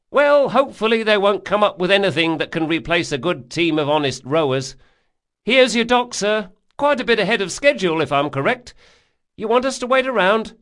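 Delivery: high, loud, obliging rower; boatman character. Acted. Boatman delivering his passenger to the dock, high and loud. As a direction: pipe it up high and loud to a customer.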